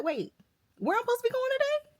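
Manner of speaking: high, fast, startled question; confused panic. Retold or imitated. Waking up sure she has work, high and startled. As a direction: shoot up high in sudden confusion. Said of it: "I said".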